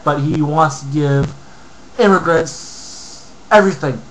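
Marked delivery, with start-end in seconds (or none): none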